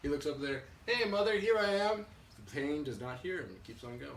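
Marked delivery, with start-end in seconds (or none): 0.9-2.1 put-on high, loud baby-bird hail; storyteller's little bird hollering for mother; calling out. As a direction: call up to someone far away. Bird calls up to plane.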